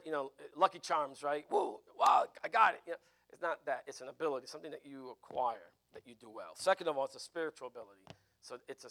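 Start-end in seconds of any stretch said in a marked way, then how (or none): 1.5-2.8 breathless, much higher and louder mock thrill; a teacher imitating a sudden flash of insight in a gleeful burst; mock eureka. Mimics a sudden moment of inspiration.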